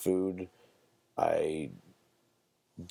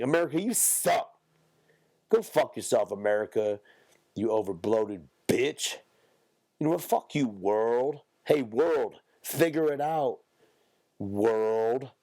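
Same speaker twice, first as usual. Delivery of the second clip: mock-angry rant, pitched up; losing control; 4 semitones higher than usual. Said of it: "lost control there for a minute".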